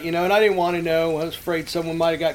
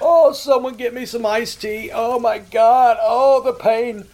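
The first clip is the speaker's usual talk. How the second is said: slow, loud, high sarcastic wailing; mock anguish; 6 semitones higher, 6 dB louder, slower than usual. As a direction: ham up being mortally wounded by an insult. Mock-wounded reply to an insult.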